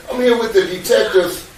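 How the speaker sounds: light nervous laugh on the line. Real, not acted. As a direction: chuckle through the words to his wife. Laughs while telling his wife.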